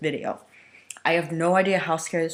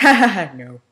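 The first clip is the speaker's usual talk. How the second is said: real laugh breaking through the word; 6 semitones higher, 9 dB louder than usual. Real, not acted. Reader laughing at the villain's request. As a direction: laughs and says no.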